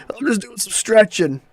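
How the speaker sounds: high dopey mock voice; careless gun guy. Mocking the gun range idiot in a high dopey voice. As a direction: brush it off like a clueless idiot.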